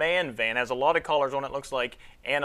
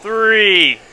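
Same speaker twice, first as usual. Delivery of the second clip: countdown shouted, building louder; 3 semitones higher, 11 dB louder, much slower than usual. Real, not acted. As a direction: call out each number, louder and higher. Counting down to the balloon ignition.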